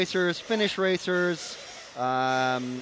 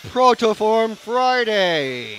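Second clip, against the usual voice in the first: slow, loud, higher announcer call; hyped announcer; 4 semitones higher, 7 dB louder, much slower than usual. Acted. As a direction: draw out Protoform Friday like a big reveal. Announcing the themed day.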